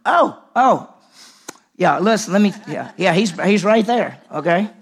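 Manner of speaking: high, loud, fluttery office lady; loud flustered secretary rushing him in; flustered receptionist. Retold or imitated. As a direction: play her flustered and eager.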